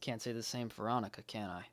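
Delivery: soft, sinking to very quiet; dejected self-blame. Acted. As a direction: let it drop away in quiet guilt. Blames self for missing Annika.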